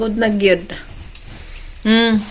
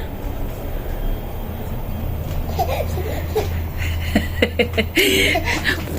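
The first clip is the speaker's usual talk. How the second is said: 1 long breathy laugh; 9 semitones higher, 7 dB quieter than usual. Real, not acted.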